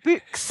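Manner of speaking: "books" with a long Scottish "oo"; amused Scottish take on "books"; Scottish-accented word. Shown as a demonstration. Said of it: "books is the other funny one".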